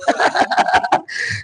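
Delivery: high, voiceless burst of laughter; soft, high wheezing laugh; breathless laugh. Real, not acted. Amused by own remark.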